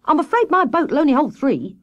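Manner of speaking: high, cool refusal; chilly, polite brush-off; cold. A narrator's reading. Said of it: "said Water Rat coldly".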